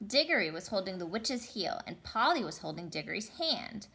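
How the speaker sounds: ordinary delivery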